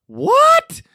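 high stunned what; shocked what. Retold or imitated. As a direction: replay the shock of hearing the draft pick. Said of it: "That was my reaction during the draft".

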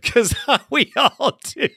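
breaking into a high laugh on "we all do". Real, not acted. Host laughing at the team's misery with callers, laughing. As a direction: laugh it off mid-sentence.

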